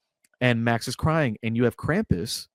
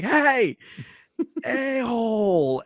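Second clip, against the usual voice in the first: much higher, slow, laughing jeer at the villain; mock taunt; 11 semitones higher, much slower than usual. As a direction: taunt the monster in a high, slow, half-laughing voice. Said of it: "He's like".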